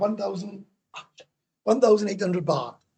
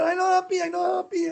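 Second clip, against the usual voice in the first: much higher mock sulky voice; imitating the girlfriend's sulky complaint, much higher; mock girlfriend sulk; 13 semitones higher than usual. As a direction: ham up her sulking, pitched up high. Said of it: "He said".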